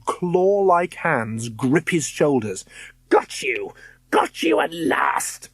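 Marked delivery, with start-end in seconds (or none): 3.1-5.5 high, slow icy witch scream. Acted. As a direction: scream it like a triumphant hag. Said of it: "screamed the icy voice".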